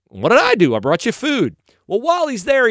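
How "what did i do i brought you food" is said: higher, louder mock protest. David answering his brothers, mock protesting. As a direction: plead innocence, pitched up. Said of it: "And he's like".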